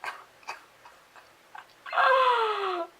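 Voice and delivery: loud laughing cry; laughing shout. Real, not acted. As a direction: shriek it through laughter. Chewing fondant, laughing cry.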